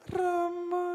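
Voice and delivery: high, drawn-out demure call; stylised high wayang-orang daughter voice; daughter addressing parent. Shown as a demonstration. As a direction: act a dutiful daughter, sustaining a high note. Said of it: "tak praktekno".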